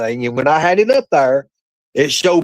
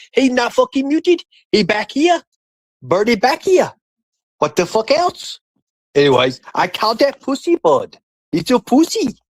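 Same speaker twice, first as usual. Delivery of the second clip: higher put-on broken-English voice; higher goofy character voice, half-joking; mock silly voice; 8 semitones higher than usual. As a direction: play a dim caricature protesting. Same put-on voice continues.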